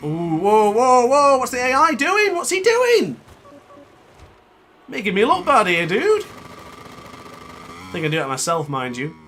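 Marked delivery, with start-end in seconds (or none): 0.0-3.2 loud, high-pitched disbelieving shout; alarmed outcry. Real, not acted. Gamer reacting to the AI rider's move, alarmed shouting. 4.9-6.2 loud, high-pitched complaint; indignant protest. Real, not acted. Gamer to AI rider making him look bad, indignant.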